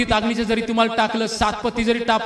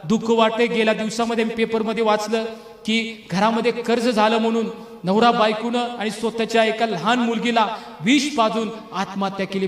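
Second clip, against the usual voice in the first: ordinary delivery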